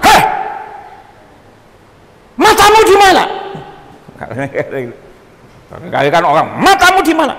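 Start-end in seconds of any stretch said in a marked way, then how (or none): 0.1-3.2 high, loud mock snap; hammed-up angry retort; mock angry rebuke. Preacher mimics an angry stranger yelling, mock angry. 6.6-7.3 mock angry rebuke.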